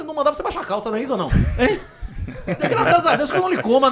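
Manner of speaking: laughing through a crude joke; laughing mid-joke about being bossed around; laughing while joking. Real, not acted. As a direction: chuckle out the teasing lines. Joking about master and slave.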